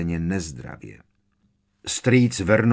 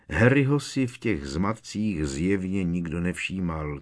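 usual speaking voice

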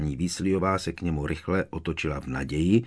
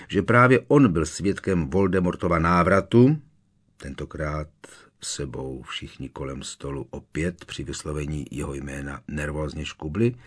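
ordinary delivery

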